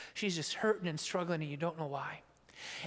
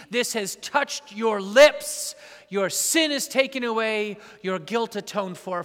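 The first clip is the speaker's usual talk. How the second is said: louder and slower, proclaiming; proclaimed loud; 2 semitones higher, 10 dB louder, slower than usual. Shown as a demonstration. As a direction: ring it out over the rail.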